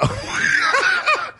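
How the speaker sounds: high-pitched burst of laughing; helpless laughter. Real, not acted. Host cracking up after the caller's remark, helpless laughter. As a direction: crack up uncontrollably. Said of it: "I'm laughing because I know why".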